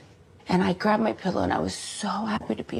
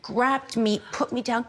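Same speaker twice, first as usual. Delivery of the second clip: ordinary talk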